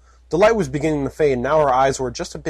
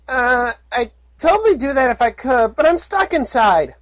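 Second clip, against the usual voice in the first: high, slowish innocent filly; sweetly oblivious little filly; filly Puppy; 11 semitones higher, 4 dB louder than usual. Acted. Filly explaining her stuck helmet, high and innocent.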